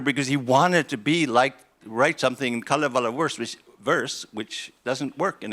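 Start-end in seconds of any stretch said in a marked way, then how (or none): none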